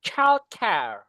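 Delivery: high, clear model words for the class to echo; repeat-after-me drill. Shown as a demonstration. Teacher leading the class in a repeat-after-me drill, high and clear. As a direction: call out each word to be repeated. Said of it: "repeat after me".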